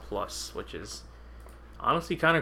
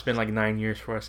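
ordinary delivery

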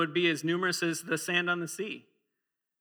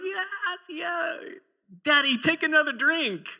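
put-on much higher, louder eager child voice; excited little boy; 12 semitones higher, 3 dB louder than usual. Little son urging dad to drink, high and eager. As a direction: squeal like an eager little kid. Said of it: "his son was just going".

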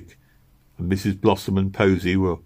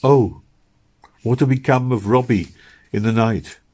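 higher, louder, frightened cry; sudden alarm; 4 semitones higher, 4 dB louder than usual. A narrator's reading. Meg remembers Robbie alone in the attic, alarmed cry. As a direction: panic as the memory floods back.

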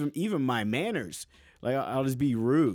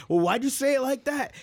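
scolding self; 11 semitones higher than usual. Retold or imitated.

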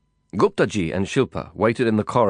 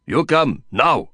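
loud, higher, gruff order; Nazir insisting; 5 semitones higher, 4 dB louder, slower than usual. A narrator's reading. Nazir pressing the narrator to come now, loud gruff order. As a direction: bark the command, no patience. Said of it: "Nazir growled in English".

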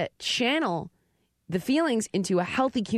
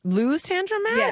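surprised; 12 semitones higher, slower than usual. Real, not acted. Surprised echo of question.

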